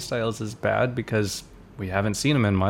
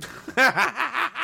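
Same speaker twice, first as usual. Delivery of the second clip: high laugh; 14 semitones higher than usual. Real, not acted. Laughs after calling him loser.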